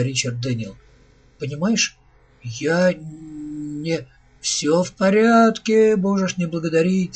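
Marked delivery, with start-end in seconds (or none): 1.4-4.1 lightly acted faltering speech; stammering, forced out. A narrator's reading. Richard Daniel lost for thanks, haltingly forcing words out. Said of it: "заикаясь, выдавил из себя". 4.4-7.2 joyful exclamation. A narrator's reading. Robot waving away Richard Daniel's thanks, joyfully exclaiming. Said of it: "радостно воскликнул робот".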